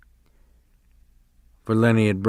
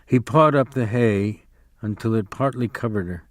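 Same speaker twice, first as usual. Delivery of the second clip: usual speaking voice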